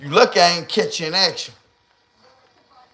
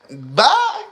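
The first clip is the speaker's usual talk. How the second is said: mock woman; 9 semitones higher than usual. Voiced woman farewell.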